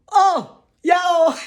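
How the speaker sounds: high, loud thrilled "oh yeah"; delighted exclaim. Real, not acted. Showing viewers her birth certificate, high and loud with delight. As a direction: burst out with joy at the document.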